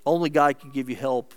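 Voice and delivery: usual speaking voice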